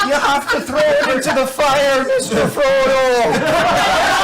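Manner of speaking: high, laughing Samwise plea; movie quote. Pretend. Player quoting Samwise at the cursed die, laughing plea. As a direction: ham up the Frodo line. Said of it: "Lord of the Rings quote".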